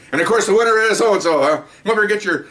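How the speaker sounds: high, proclaiming presenter voice; award announcer. Retold or imitated. Presenter to the squads, announcing the gold-car winner. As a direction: build up the winner like an awards host.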